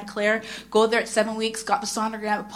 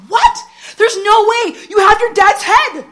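much higher and louder mock husband voice; husband's disbelief; 11 semitones higher, 9 dB louder than usual. Husband insisting she looks like her dad, high and loud. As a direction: play the husband scoffing in disbelief. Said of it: "He's like".

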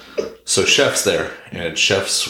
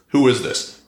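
mock 911 operator; 7 semitones higher, 3 dB louder than usual. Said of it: "Nine one one tape sounds like".

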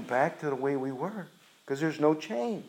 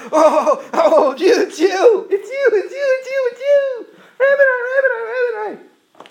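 very high, loud cries of joy; overjoyed shouts; 10 dB louder than usual. Acted. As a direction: shriek with joy at the recognition. Mary clinging to Jesus, overjoyed.

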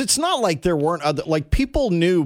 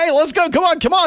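higher, louder cheer; cheering fan; 12 semitones higher, 5 dB louder, faster than usual. Retold or imitated. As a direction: cheer the runner on. Voicing student fans cheering.

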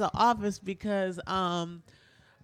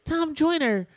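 high, gushing fan voice, a bit louder; starstruck gushing; 7 semitones higher, 3 dB louder than usual. Retold or imitated. Fan gushing at radio idol Tom Joyner, high and starstruck. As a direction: gush at your hero.

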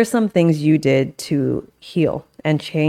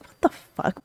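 whispered curse; 7 dB quieter than usual. Retold or imitated. Angry reaction when feeling unsafe.

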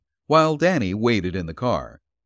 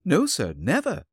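firm, higher emphatic denial; 7 semitones higher than usual. Acted. Landlady denying any visitors, emphatic. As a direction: insist firmly on never. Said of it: "said Missus Lord emphatically".

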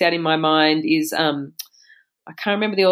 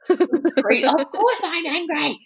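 much higher, slow, mock indignant child; imitated angry retort; 11 semitones higher, much slower than usual. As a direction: drag it out like an outraged kid.

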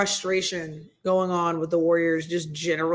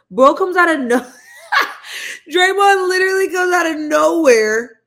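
laughing; 8 semitones higher, 6 dB louder than usual. Real, not acted. Laughing at the footage.

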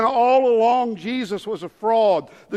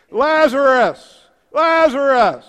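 loud, high, drawn-out calls; Jesus calling loudly; 4 semitones higher, 8 dB louder, slower than usual. Acted. Preacher as Jesus at the tomb, calling loudly. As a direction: summon the dead at full voice. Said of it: "you gotta talk loud to 'em".

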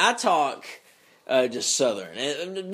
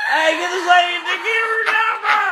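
high, loud, comic yell; mock hollering; 12 semitones higher, 6 dB louder than usual. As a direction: holler for help in hammed-up outrage. Mock hollering about the giggling co-host.

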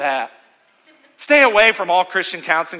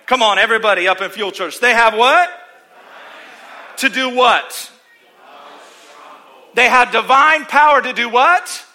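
preaching; 5 semitones higher, 7 dB louder than usual. Acted. Preaching call and response.

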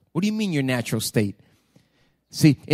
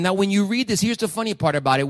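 ordinary talk

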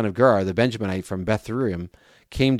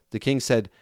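usual speaking voice

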